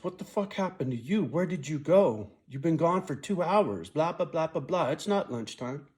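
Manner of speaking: hammed-up boss chewing him out; imitating the boss demanding where he went; mock angry boss. Boss quoted scolding him.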